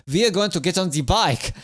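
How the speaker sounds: lilting mock German accent. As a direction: put on a comic German accent. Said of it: "we're German now".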